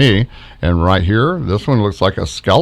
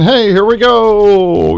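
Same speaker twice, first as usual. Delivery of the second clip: high, slow, drawn-out greeting; playful high-pitched hello from an orb; cheerful entity voice; 10 semitones higher, 5 dB louder, slower than usual. Retold or imitated. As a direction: sing it out like a friendly spirit calling.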